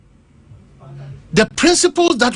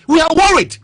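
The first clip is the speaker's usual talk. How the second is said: raised, urgent and insistent; emphatic worry; 6 semitones higher, 3 dB louder than usual. Real, not acted. Telling the panel the country is worried, emphatic. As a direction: press the worry on them firmly.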